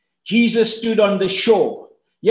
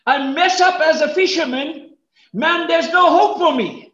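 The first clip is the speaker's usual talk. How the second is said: despairing Peter; 8 semitones higher than usual. Retold or imitated. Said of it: "He's thinking".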